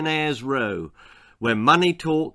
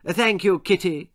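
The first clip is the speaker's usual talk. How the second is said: higher, mannered courtesy; polite thanks; 6 semitones higher than usual. Acted. Chairwoman thanking Missus Deacon, politely. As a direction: thank a neighbour with formal politeness. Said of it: "said Missus Pye politely".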